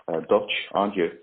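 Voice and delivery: ordinary delivery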